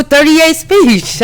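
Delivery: "Speech" with a long ee in place of Spesh; "Speech" said wrong, unbothered; habitual mispronunciation. Real, not acted. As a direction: say the name as "thirty-eight Speech", ending like the word speech. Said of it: "I can't say it like that".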